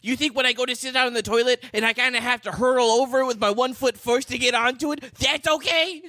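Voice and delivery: mock ranting short man. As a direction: ham up the furious little man's outrage.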